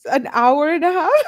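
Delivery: imitating a stunned friend's disbelief; incredulous. Friend reacting to the ninety-minute outfit panic, shocked and high. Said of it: "Tay says".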